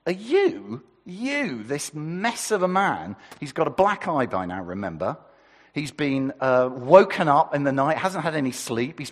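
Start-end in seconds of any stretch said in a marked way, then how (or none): 0.1-3.2 pitched up high, sneering at the prisoner; mock him in a high incredulous voice; sneering Roman governor; high scornful disbelief. Shown as a demonstration. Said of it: "Or maybe it's".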